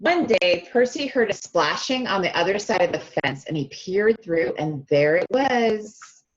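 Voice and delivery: plain reading voice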